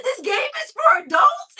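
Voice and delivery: very high, loud retold question; shocked disbelief. Mother learning the game is for adults, shocked disbelief. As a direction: shriek the question in mock outrage.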